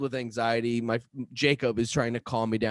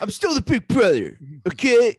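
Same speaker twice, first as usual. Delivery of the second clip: higher, louder playful bossiness; mock sibling squabble; 7 semitones higher, 5 dB louder than usual. Big brother pulling rank, mock-indignant and raised.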